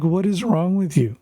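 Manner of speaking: incredulous dad telling off his daughter; exasperated scolding. Retold or imitated.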